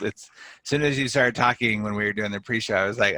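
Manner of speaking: normal talking voice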